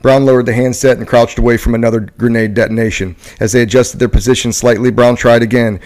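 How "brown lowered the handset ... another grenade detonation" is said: ordinary talk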